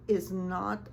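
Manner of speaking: usual speaking voice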